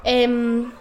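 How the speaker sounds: normal talking voice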